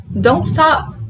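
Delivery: don't stop with no T on don't; don't stop linked, high and loud; dropped T. Shown as a demonstration. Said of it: "tirar o som do T".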